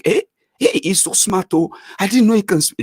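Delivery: high, mock-amazed gasp of praise; impressed onlookers. Mock-amazed friends gushing over the smart one. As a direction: gush like a dazzled fan.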